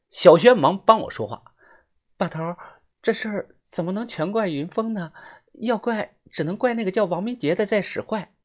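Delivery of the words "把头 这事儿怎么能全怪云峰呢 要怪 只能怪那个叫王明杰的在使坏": put-on high-pitched young companion; Xiaoxuan pleading for a friend. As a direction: speak up in a high, protesting voice. (小轩尖细嗓音替人辩解)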